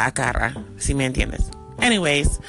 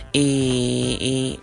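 ordinary delivery

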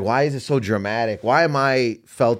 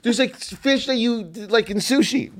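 high-pitched giddy imitation; excited about fish; 11 semitones higher than usual. Snorkeler describing fish to friend, excited. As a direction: pipe it up like an overexcited grown man. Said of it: "grown-ass men get so excited about fish".

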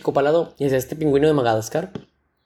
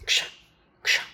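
short, noisy "ksha" cutting sounds; imitated guillotine chops; 6 dB quieter than usual. Storyteller shows himself cutting metal sheets, crisp chopping noises. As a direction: slice with the voice, one chop each.

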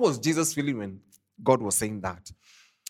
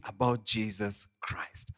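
whispered; 5 semitones lower, 8 dB quieter, slower than usual. Acted. Preacher hushes key line.

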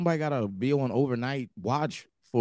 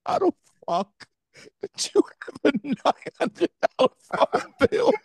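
high-pitched, laughing protest, getting louder; laughing disbelief; 4 dB louder than usual. Real, not acted. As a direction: squeal it out through laughter, can't believe it. Incredulous reaction to phone bill.

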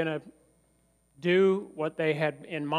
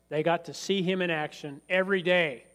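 ordinary delivery

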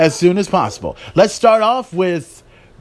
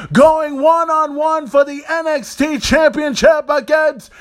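a real shout, pitched higher, stretching out the name; riled up; 7 semitones higher than usual. Real, not acted. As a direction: let it build into an excited announcer yell. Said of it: "almost got riled up there".

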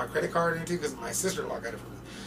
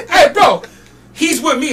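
high, loud vouching shout; calling out; 11 semitones higher, 11 dB louder than usual. Retold or imitated. Roadie vouching for fan to security, calling out. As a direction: step in loudly for a friend.

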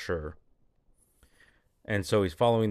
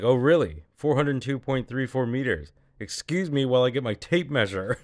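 higher, louder mock disbelief; incredulous, raised-voice complaint; exasperated sarcasm; 5 semitones higher, 5 dB louder than usual. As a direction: play the indignant hero protesting an absurd order. Acts exasperated hero under fire.

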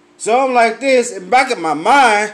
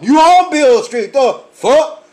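louder, higher, exasperated; exasperated retort; 4 semitones higher, 5 dB louder than usual. Retold or imitated. Answering a tourist standing on Beale Street, loud and exasperated. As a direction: blow up at the tourist's question.

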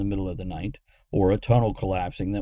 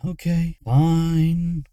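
exasperated, higher-pitched giving in; sighing; 7 semitones higher, 4 dB louder, much slower than usual. A narrator's reading. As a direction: give in with a sigh. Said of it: "Luna sighed".

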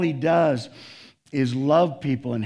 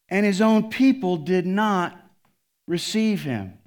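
scripture reading; 6 semitones higher, 5 dB louder than usual. Acted.

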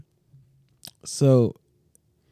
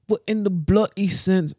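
higher-pitched, badly faked British accent; 7 semitones higher, 3 dB louder than usual. Co-host unsure how to do it, British accent. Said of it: "how to do a British".

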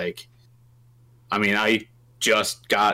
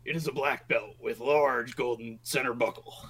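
hushed, whispery wrestler voice; Hogan; 6 dB quieter than usual. Acted. Game master describing the belt, hushed wrestler impression. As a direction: drop to a rasping whisper, still the wrestler. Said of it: "Belt of the Hogan".

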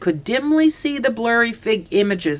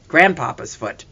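ordinary delivery, nothing marked

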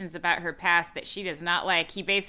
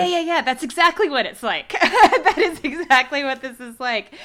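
loud, high, laughing recognition; laughing agreement; 7 semitones higher, 8 dB louder than usual. Real, not acted. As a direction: agree emphatically through real laughter.

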